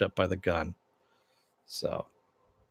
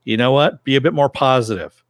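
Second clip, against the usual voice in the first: loud, firm retold command; louder, insistent mock command; 3 semitones higher, 6 dB louder than usual. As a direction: imitate a compelling order, punched out. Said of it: "uses the voice on him".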